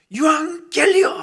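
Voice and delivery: herald's shout. Acted. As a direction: cry it out to the whole city from the gate. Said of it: "이렇게 외치는 거예요".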